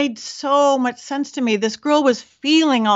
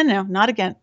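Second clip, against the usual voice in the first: ordinary delivery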